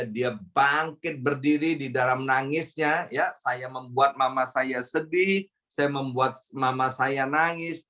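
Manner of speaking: ordinary talk, nothing marked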